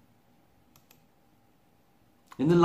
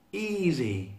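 higher, a touch of a yell; 5 semitones higher than usual. Acted. Giraffe answering Kudu, cocky light yell. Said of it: "yelled Giraffe".

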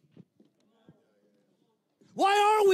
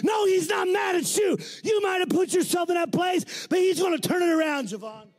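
full-force preaching; 8 semitones higher than usual. Acted. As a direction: preach it hard over the crowd.

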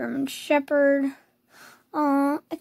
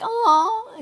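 a high, louder aw like a little bird; 9 semitones higher, 4 dB louder than usual. Retold or imitated. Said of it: "it sounded like I was, like, a bird".

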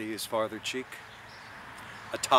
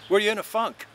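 high, louder mocking jab; teasing Mulligan; 10 semitones higher, 5 dB louder than usual. A narrator's reading. Mulligan teasing Stephen with a cheeky high jab. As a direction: needle him with a cheeky grin.

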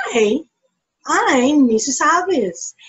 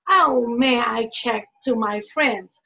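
normal talking voice

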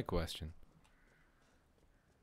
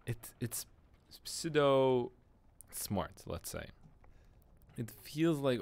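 usual speaking voice

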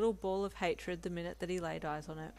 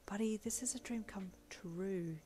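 slow soft whisper to yourself; inner voice; 4 dB quieter than usual. Acted. Assistant thinking to herself about her boss, slow whisper. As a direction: whisper the secret thought, slowly. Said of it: "you said internally".

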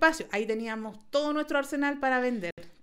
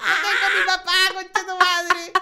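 laughing shout; 6 semitones higher, 6 dB louder, slower than usual. Retold or imitated. Acted comeback to the threat.

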